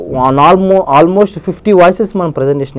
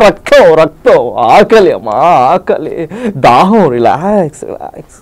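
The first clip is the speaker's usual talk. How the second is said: Sai Kumar impression. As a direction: deliver it like a fiery Telugu cop-movie hero. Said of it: "Sai Kumar గారి voice". (Sai Kumar గారి police story dialogue శైలిలో)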